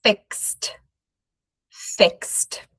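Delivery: fixed ending in a soft kst; fixed as one syllable. Shown as a demonstration. Teacher modelling fixed for students to repeat. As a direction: say fixed as fikst. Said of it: "practice the pronunciation".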